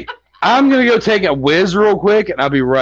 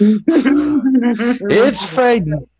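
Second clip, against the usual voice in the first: mournfully drawn-out; 5 semitones higher, much slower than usual. Pretend. Drawn-out repeat.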